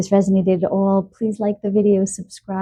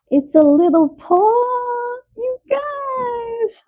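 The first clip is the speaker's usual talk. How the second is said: high-pitched coo after a gasp; gushing delight; slower than usual. Real, not acted. Reader cooing to viewers over a little paw charm, gushing delight. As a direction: coo over something adorable, voice soaring.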